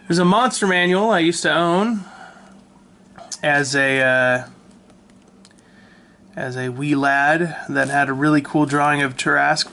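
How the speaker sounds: usual speaking voice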